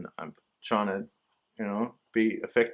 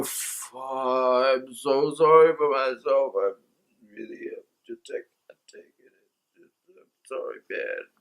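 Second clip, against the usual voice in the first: self-pity. Retold or imitated. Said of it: "have to just go".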